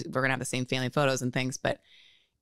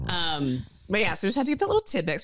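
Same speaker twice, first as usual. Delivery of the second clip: ordinary talk, nothing marked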